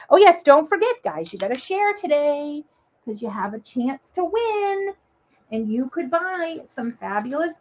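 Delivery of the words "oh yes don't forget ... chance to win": usual speaking voice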